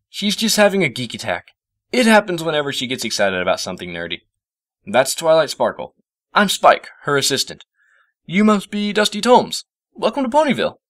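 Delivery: put-on high, chirpy boy voice; cheerful greeting; friendly Spike. A narrator's reading. Spike welcomes the professor, high and chirpy.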